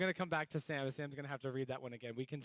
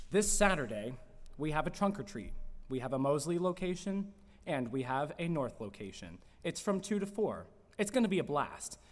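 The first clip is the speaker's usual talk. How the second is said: news read; 3 semitones higher, 9 dB louder than usual. Acted. News segment announcement.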